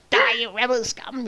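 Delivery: high-pitched pretend battle cry; squeaky mock trooper threat; playing stormtrooper. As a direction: imitate a kid yelling at imaginary rebels. Plays kid as stormtrooper.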